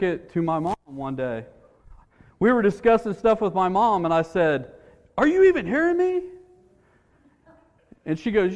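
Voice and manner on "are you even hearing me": high, quick, rising challenge; incredulous question. Retold or imitated. Son checking whether mom is listening, incredulous. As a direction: ask it in high-pitched disbelief.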